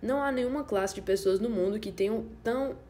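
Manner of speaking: plain reading voice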